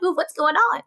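curious. Retold or imitated. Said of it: "I'm like".

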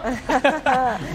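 brief laugh after singing; short laugh. Real, not acted. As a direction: laugh at your own chant.

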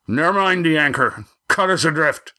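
high, urgent orders; shouted order. Acted. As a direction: call out orders over danger.

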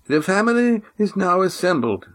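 put-on formal old man. A narrator's reading. Old Oron welcomes the Silver Swan, formal. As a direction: greet her like a stiff elder.

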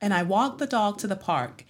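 standard American accent. Shown as a demonstration. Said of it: "use that standard American accent".

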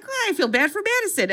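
a high, put-on sympathetic viewer; mock viewer voice. Host voicing the audience's pity, high mock sympathy. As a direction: voice the audience pitying her, lifted high. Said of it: "you're like".

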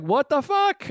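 high-pitched New York exclamation; New York accent. Retold or imitated. Bonding with two mooks, indignant New York accent. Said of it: "talking in a New York accent back".

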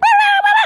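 very high loud bird screech; squawk. Retold or imitated. Bird fight reply, very high loud screech. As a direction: shriek back like a bird. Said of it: "he was like".

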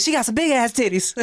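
high, loud put-on leering; mimicked star. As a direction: imitate him gawking, on the edge of laughter. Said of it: "he'd be like".

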